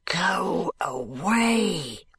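slow, hollow ghostly command; a lightly voiced grim ghost, drawn out; spectral voice. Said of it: "in a spectral bass voice".

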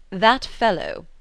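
mildly indignant scorn; lightly voiced annoyed gentleman. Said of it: "said he indignantly".

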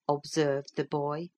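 usual speaking voice

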